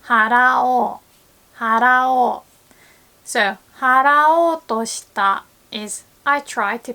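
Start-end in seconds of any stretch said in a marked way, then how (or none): 0.0-2.4 払おう drawn out slowly with the long お; slow model word. Shown as a demonstration. Teacher showing learners the changed 払おう form. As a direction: stretch 払おう, stressing the new お ending. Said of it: "change う into お".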